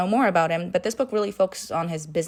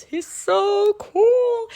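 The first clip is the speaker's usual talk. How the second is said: high, slow dreamy sigh of a line; swooning gush; 6 dB louder, much slower than usual. Real, not acted. Fangirl swooning over Hades, high and slow. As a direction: melt over him, drawn out way up high.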